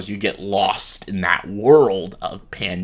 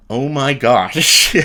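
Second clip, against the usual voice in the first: drawn-out "oh my gosh", louder and higher; slow exasperated gasp; 4 semitones higher, 5 dB louder, much slower than usual. Retold or imitated. Reacting to [name] rapping, slow stunned sigh. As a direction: let it out slowly, disbelieving. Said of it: "I'm like".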